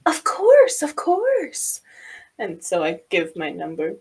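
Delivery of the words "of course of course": high, loud, gushing reassurance; bright-eyed yes, higher and louder; eager agreement. Acted. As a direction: jump in keenly, pitched way up.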